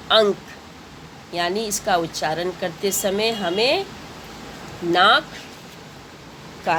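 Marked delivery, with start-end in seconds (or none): 0.1-0.4 अंक said with a nasal ङ before the क, ang-k; anusvara demo. Shown as a demonstration. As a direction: voice अंक through the nose, ang-k. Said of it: "उच्चारण कैसे करेंगे". (अंक को नाक से, अनुस्वार की ध्वनि के साथ)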